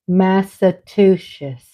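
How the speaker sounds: Massachusetts said slowly as mass-a-TOO-shus; mispronounced. Shown as a demonstration. Host confessing how she used to say it, slow mispronunciation. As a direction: end "Massachusetts" in a slushy "shus". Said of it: "I thought this state was pronounced".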